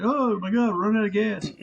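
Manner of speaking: a lightly mocking frantic cry; mock panic. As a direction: play the panicking public, half-joking. Mocking gas crisis panic.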